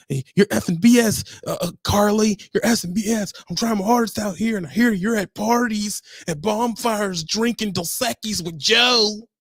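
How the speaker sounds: high, loud hammed-up phone rant; mock angry yell. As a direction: rant like a jealous trucker yelling at his girlfriend. Acted angry trucker on phone.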